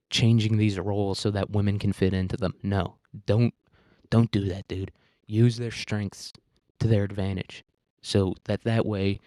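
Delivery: normal talking voice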